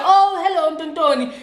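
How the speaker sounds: high, sweet mock-polite greeting; polite sing-song hello. Suggesting the rude guy greet her politely, high sweet hello. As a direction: show how nicely he could have said it. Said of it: "You can literally just be like".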